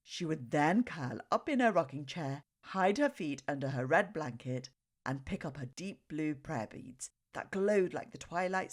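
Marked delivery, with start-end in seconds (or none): none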